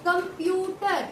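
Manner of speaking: कंप्यूटर said slowly as कम-प्यू-टर; syllable breakdown. Shown as a demonstration. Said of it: "third word में एक और". (कंप्यूटर को धीरे धीरे हिस्सों में तोड़कर)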